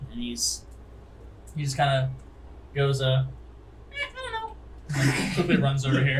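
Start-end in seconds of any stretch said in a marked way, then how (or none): 3.9-5.3 very high, slow gnome squeak. Acted. Old gnome answers the captain.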